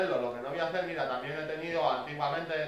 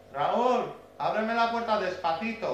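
raised, higher call through a door; 6 semitones higher, 4 dB louder, slower than usual. Retold or imitated. Imitating Pedro calling Raúl from the kitchen, calling out. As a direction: call to a friend in the next room.

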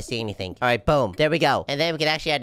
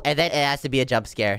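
usual speaking voice

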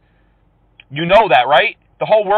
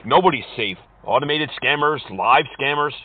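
usual speaking voice

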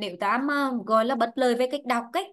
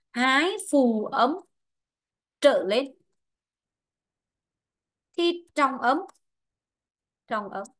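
slow, phrase-by-phrase dictation; dictating; slower than usual. Real, not acted. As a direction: leave long pauses for students to write. Teacher dictates a rule for notes. (đọc chính tả chậm, ngắt từng cụm)

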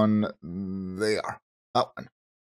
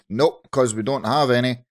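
ordinary delivery

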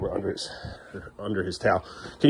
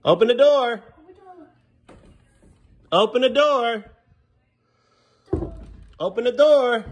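higher, louder repeated call; insistent call to open up; calling through the door; 13 semitones higher, 4 dB louder, slower than usual. Real, not acted. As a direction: call out so she hears inside.

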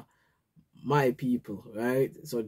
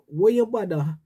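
higher, louder Jamaican patois grumbling; exasperated Jamaican patois rant; 4 semitones higher, 4 dB louder than usual. Retold or imitated. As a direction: complain like an ungrateful crowd in Jamaican patois. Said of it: "I'm gonna say in Jamaican".

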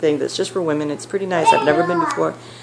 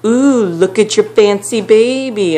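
higher, louder, slow mocking coo; mock taunting drunk; 6 semitones higher, 5 dB louder, slower than usual. Drunk woman leering at the baby, slow mocking coo. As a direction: taunt the mother slowly, sing-song. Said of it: "she's like".

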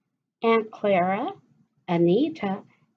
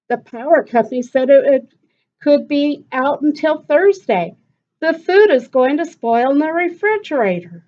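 higher, louder and fast alarmed telling; yell; 6 semitones higher, 7 dB louder than usual. Acted. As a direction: hurry the bad news out to the kids. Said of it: "Mama yelled".

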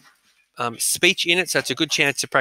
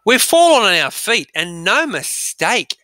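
put-on bright, higher, slower boy's voice; voicing Peter; 6 semitones higher, 4 dB louder, slower than usual. Teacher reading Peter's line, pleased and bright. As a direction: play Peter, pleased with their luck. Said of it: "said Peter".

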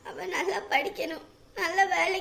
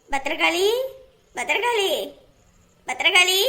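louder each time she calls; shouting the name, getting louder; calling out loud; 7 dB louder than usual. Acted. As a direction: call her name out across the house. Calling daughter from outside.

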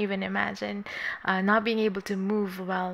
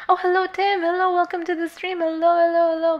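excited greeting; 8 semitones higher, 4 dB louder than usual. Real, not acted. Greeting a returning viewer.

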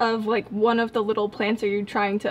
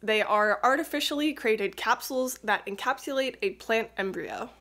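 normal talking voice

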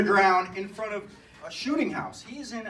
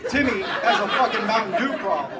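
much louder, hammering the punchline; loud comic proclamation; emphatic repeat; 8 dB louder than usual. Real, not acted. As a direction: shout it out to the crowd.

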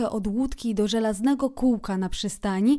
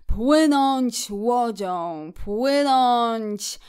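very slow, rapt reverie, louder swells; dreamy; 2 semitones higher, 5 dB louder, much slower than usual. A narrator's reading. Szczur carried away while rowing, dreamy. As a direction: draw every word out dreamily. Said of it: "ciągnął dalej rozmarzony".

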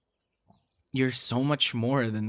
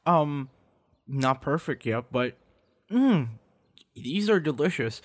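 high, loud yummy sounds; delighted tasting; 4 semitones higher, 5 dB louder than usual. Real, not acted. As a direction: savor every bite. Tasting the food.

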